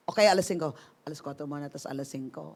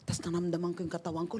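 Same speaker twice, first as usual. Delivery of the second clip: ordinary delivery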